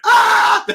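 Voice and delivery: sudden high yelp of surprise; startled yell. Real, not acted. Jolted by the tiger clip, a loud yelp. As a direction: yelp out loud at the jump scare.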